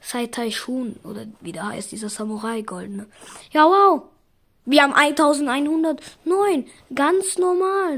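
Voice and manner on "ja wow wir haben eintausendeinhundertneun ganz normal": loud, higher-pitched burst of amazement; excited reaction. Real, not acted. Gamer reacting to the count of eintausendeinhundertneun, loud and excited. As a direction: exclaim it loud and high, drawing out the end. Reacts loudly to a pull total.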